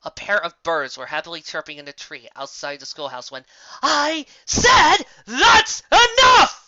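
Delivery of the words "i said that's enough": very high, very loud, slow roar; furious shout. Acted. Patient teacher snapping at the class, furious shout. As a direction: blow the roof off.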